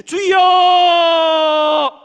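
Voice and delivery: long high full-voiced shout; crying out Lord. Shown as a demonstration. Pastor showing how to cry out in prayer, loud. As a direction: cry out to God with all you have. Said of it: "기도할 줄 아는 사람".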